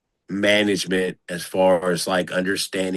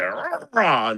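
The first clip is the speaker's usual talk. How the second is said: rah-rah coach; 10 semitones higher than usual. Retold or imitated. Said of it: "I was always the rah-rah coach".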